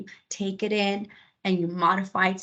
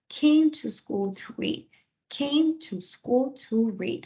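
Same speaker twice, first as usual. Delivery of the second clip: slow dictation; 4 semitones higher, slower than usual. Shown as a demonstration. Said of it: "I like to slow it down".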